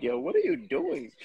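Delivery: high-pitched mock wife; imitating wife. Host quoting his wife over there, imitated high voice. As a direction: put on a high voice to question someone. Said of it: "my wife over there like".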